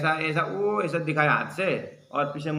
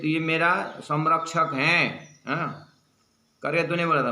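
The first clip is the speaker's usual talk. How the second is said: normal talking voice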